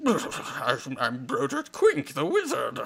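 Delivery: put-on comic wizard voice; hammed-up wizard character voice; Broderick Quink. Shown as a demonstration. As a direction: introduce yourself as a silly wizard character. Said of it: "hear me go".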